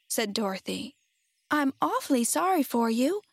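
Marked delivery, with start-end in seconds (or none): 1.5-3.2 put-on higher, pitying little girl's voice; sympathetic girl. A narrator's reading. Girl pities the brainless Scarecrow, in a higher sympathetic voice. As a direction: offer sympathy in a raised young voice.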